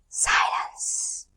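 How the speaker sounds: breathy hushed whisper. A narrator's reading. Narrator hushing the listeners, whispered. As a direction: let the word fall into silence. Dramatic pause word in story.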